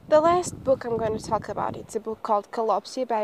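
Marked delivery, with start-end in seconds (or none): none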